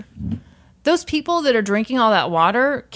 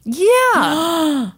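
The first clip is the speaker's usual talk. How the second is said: outraged; 14 semitones higher, 4 dB louder, much slower than usual. Real, not acted. Outraged about on-air contest.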